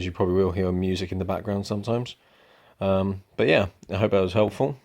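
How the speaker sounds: usual speaking voice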